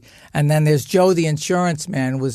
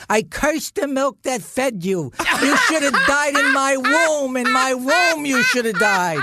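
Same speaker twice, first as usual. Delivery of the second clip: much higher, hammed-up tragic curse through laughter; melodramatic curse; 8 semitones higher than usual. Mother curses her son at the dinner table, hammed-up and much higher. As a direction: play it like an operatic mother's curse. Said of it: "and she goes".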